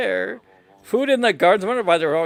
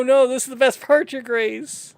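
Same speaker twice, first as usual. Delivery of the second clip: usual speaking voice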